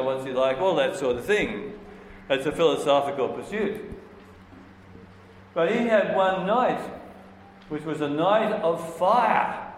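ordinary delivery

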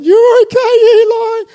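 mock a frantic high-pitched reassurance; squeaky panicky reassurance. Father mocking a panicked version of his calm words, high and squeaky. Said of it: "It wasn't".

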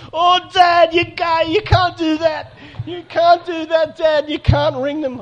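put-on high, slow, whiny teen protest; protesting teenage daughter. Teenage daughter begging dad not to call, high and whiny. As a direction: plead like a teenager who's been caught.

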